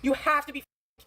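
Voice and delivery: ordinary talk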